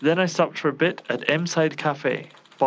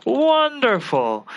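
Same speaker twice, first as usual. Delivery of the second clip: drawn-out gloating "Wonderful!" pitched up high and loud; mocking praise; 9 semitones higher, 4 dB louder, much slower than usual. Villain gloating at the detective who defused the bomb, mocking. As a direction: savour the word like a smug villain.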